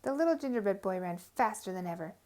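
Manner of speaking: usual speaking voice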